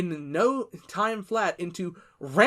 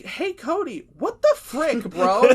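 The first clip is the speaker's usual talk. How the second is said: mock outraged friends; 5 semitones higher, 6 dB louder than usual. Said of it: "everybody's like".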